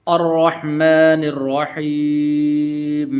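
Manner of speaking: slow chanted recitation with a full ra; Quran recitation. Shown as a demonstration. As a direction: recite slowly, giving the ra a full sound. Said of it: "اس کو اس طرح پڑھیں گے".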